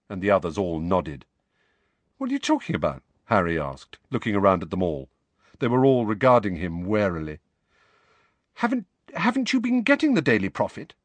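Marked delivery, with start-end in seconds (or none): 2.2-3.0 put-on much higher, puzzled boy's question; Harry caught off guard; Harry, bewildered. A narrator's reading. Harry asks his friends, bewildered. 8.5-10.9 put-on much higher, hesitant and quick girl's voice; nervously. A narrator's reading. Hermione asks Harry nervously, high and quick. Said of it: "Hermione asked nervously".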